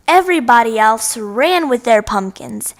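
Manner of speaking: ordinary delivery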